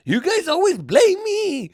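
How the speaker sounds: high, slow wail; hammed-up tearful whine; mock crybaby. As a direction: wail the accusation, dragging it out. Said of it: "I cried myself out of a situation".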